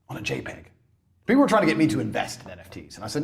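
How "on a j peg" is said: incredulous whisper. Real, not acted. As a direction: whisper it in disbelief. Whispered disbelief.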